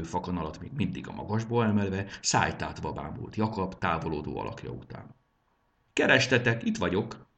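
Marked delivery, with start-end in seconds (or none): 5.9-7.3 bright, cheery call across the room; cheerful call. A narrator's reading. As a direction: call out in good spirits. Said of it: "kiáltotta a fiú jókedvűen". (vidám kiáltás)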